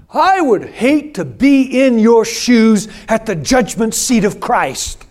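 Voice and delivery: mock hostile pastor's warning; retold angry threat. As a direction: hurl the threat at him, slow and loud. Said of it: "screaming at me".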